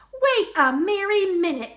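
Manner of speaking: cheerful elf calling for a stop; cried out. Acted. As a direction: elf catching the candy cane turkey out. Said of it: "cried an elf".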